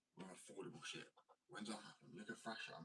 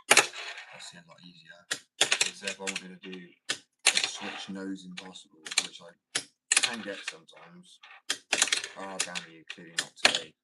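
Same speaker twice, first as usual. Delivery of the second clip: frustrated; 15 dB louder than usual. Real, not acted. Frustrated at failed trick.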